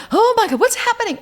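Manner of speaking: loud, very high cry of alarm; panicked. Retold or imitated. Two friends jumping out of bed in the quake, panicked. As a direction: re-enact a panicked yelp.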